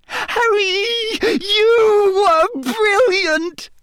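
very high, drawn-out squeal; squeaky. A narrator's reading. Hermione darting in to praise Harry, squeaky. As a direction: squeal it slowly, overcome with excitement. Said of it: "Hermione said squeakily".

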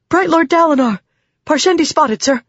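high-pitched breathless alert; urgent messenger. A narrator's reading. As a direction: call out the alarm to a commander.

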